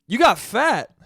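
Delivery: can't hold back a laugh at the uncle's line; laughing uncle quote. Retold or imitated. Son retelling uncle's blunt greeting, laughing. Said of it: "the first thing he says to my dad is".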